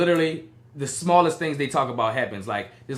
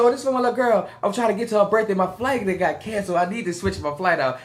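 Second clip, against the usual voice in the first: higher, pleading traveler voice; pleading self; 6 semitones higher than usual. Retold or imitated. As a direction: beg sweetly for help, voice pitched up. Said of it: "I said".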